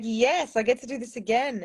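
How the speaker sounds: a touch of giddy triumph; mildly excited. Retold or imitated. Retelling walking the stage again, mildly excited. Acts out her delight on stage.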